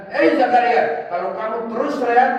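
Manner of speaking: loud, high-pitched admonition; stern warning. Acted. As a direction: call out sharply to warn him. Voicing God warning Zakaria.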